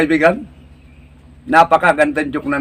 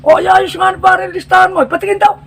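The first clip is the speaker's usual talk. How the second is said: higher, prying buddy's voice; nosy barkada; 13 semitones higher than usual. Acted. Friend at the corner questioning the one with the list, high and nosy. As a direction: quiz your friend about the paper.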